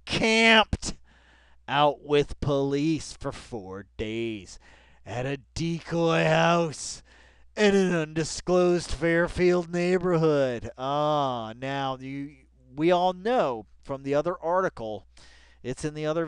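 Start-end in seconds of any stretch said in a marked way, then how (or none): 0.1-10.7 slow, louder, savouring every phrase; dramatic reading. Pretend. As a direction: read it like a thriller trailer.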